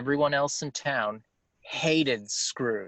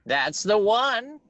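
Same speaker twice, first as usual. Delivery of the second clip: put-on old miser voice; 9 semitones higher, 6 dB louder, slower than usual. Scrooge, loud and slow.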